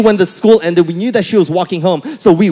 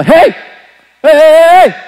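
high, loud calling out; repeated loud shouts across the street; hollering; 9 semitones higher, 7 dB louder than usual. Retold or imitated. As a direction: holler after someone to stop. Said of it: "holler at me".

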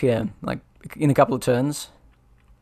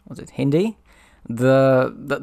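ordinary talk, nothing marked

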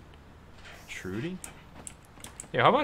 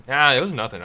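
loud, punchy brush-off opening on a big "Ah"; 3 semitones higher, 10 dB louder than usual. Acted. Gamer voicing his character's reply to praise, loud brush-off. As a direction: wave it off loudly. Replays the reply more forcefully.

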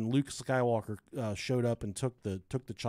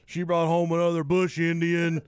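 put-on southern accent; 4 semitones higher, 5 dB louder than usual. Break room guy rants in a mock Southern accent. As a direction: ham up an angry Southern guy at work, louder. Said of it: "you use a southern accent".

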